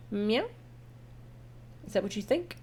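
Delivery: ordinary delivery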